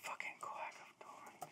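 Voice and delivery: angry whisper; whispered curse. Real, not acted. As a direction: hiss it under your breath. Muttered annoyance at losing vote.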